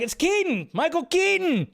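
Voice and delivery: high-pitched excited yell; retold yell. Fan telling the party who showed up, excited yell. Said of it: "I just yelled it out".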